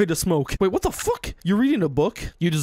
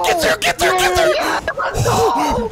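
frantic cheering shout; 11 semitones higher, 5 dB louder than usual. Real, not acted. Cheering the car race.

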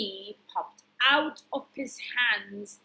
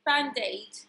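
ordinary delivery, nothing marked

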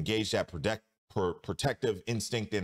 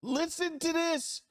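much higher, slow put-on voice; high mock voice; slower than usual. Real, not acted. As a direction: squeak it out incredulously. Host reacts in mock voice.

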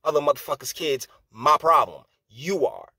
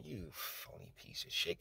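much softer, whispered contempt; whispered insult; 3 semitones lower, 21 dB quieter than usual. Acted. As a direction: hiss it under the breath. Closing insult after rant.

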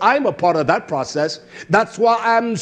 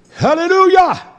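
a high, loud cry of praise; shouted hallelujah; 11 semitones higher, 6 dB louder than usual. Real, not acted. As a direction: burst out in praise. Shouted praise after quiet lines.

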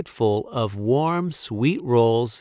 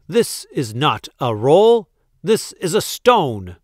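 loud, emphatic indignation; roaring; 4 semitones higher, 6 dB louder than usual. A narrator's reading. Duke rejects the baker's roll, roaring. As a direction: hammer out the complaint. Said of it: "roared the Duke".

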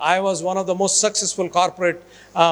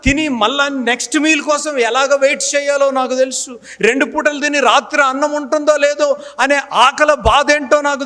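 raised, loud preaching voice; fervent testimony; 7 semitones higher, 4 dB louder than usual. Real, not acted. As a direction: preach it with rising fervour. (ఉత్సాహంగా బోధించే స్వరం)

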